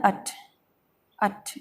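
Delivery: अट with a short uh; short schwa. Shown as a demonstration. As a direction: say अट as uh plus t. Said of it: "ये अ है ना".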